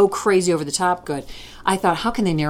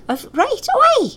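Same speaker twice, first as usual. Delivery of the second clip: much higher, broad Scots oh aye; Scottish accent. Retold or imitated. Host stays stuck in Scots talking about mother, high broad Scottish accent. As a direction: sing it up high like a Scottish granny. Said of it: "totally stuck in the Scots".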